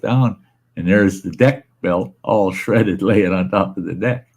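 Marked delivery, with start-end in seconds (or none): none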